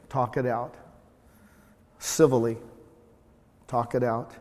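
ordinary talk

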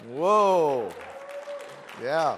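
big loud drawn-out whoa; a loud delighted whoa for his wife. Real, not acted. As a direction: cheer her name out loud. Crowd reacts to Cheryl.

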